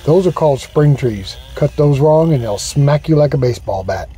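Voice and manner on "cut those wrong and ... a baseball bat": usual speaking voice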